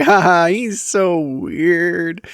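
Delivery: slow, fake polite chuckle; a hint of the wife's strained politeness; mock forced laugh. Wife faking a laugh for friends, strained. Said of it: "She's just like".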